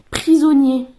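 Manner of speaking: very loud one-word reveal; card announcement. Real, not acted. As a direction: call the card out boldly. Announcing each card drawn.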